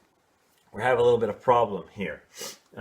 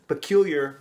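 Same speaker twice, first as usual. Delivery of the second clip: "Peculiar" louder, with its C popped out sharply; hard C; 3 semitones higher, 4 dB louder than usual. Shown as a demonstration. Teacher shows the hard consonant in peculiar. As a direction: snap the C in "Peculiar". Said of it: "you want to emphasize the C".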